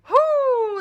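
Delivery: high, loud exasperated whoo; frustrated whoo. Real, not acted. Reacting to toxic people, a loud exasperated whoo. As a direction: blow off steam with a sharp whoo.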